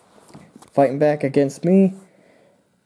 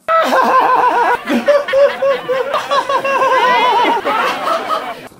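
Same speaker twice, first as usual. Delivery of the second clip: long, very high cackle; mocking cackle; 4 dB louder than usual. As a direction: keep cackling high at the loser. Jeering laugh at the villain.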